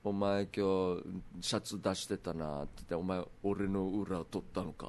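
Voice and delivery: slow, low menacing hitman; low, unhurried threat; Golgo 13. Acted. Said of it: "ゴルゴサーティーンだったら殺されてるよ".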